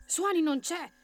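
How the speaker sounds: put-on high-pitched young rabbit; high, small hero voice; little rabbit. A narrator's reading. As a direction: answer eagerly, pitched well up. Rabbit answers the elf.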